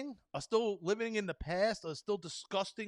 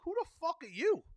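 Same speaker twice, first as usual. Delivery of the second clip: exasperated outburst; 10 semitones higher than usual. Real, not acted. Ranting at people who'd bar him from hospital, incredulous outburst. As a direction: pitch jumps way up in disbelief.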